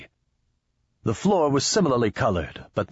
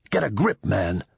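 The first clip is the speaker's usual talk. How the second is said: usual speaking voice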